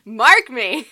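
loud, high dramatic Scottish oath; theatrical shout. Pretend. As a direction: belt it out like a vow. Echoes catchphrase louder, theatrical.

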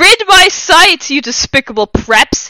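high, very loud theatrical fury; shouting. A narrator's reading. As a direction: bellow like a melodramatic villain. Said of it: "he shouted".

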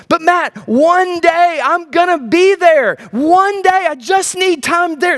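high, drawn-out mock pleading; pleading objector. Preacher voicing a congregant's excuse, mock pleading. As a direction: plead it slowly, imitating an objector.

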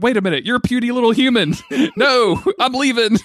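high, fast, laughing through Hulk's words. Retold or imitated. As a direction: giggle through a dumb Hulk line. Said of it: "He's like".

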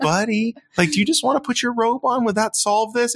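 put-on high, gentle question to a toddler; sing-song parent comforting; coaxing parent.

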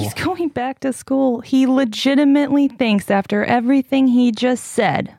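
high-pitched, incredulous insistence; appalled and worked up; real dismayed disbelief. Real, not acted. Upset disbelief after hearing clip.

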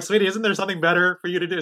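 impatient parent voice, half joking; mock exasperated dad. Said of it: "And I'm like".

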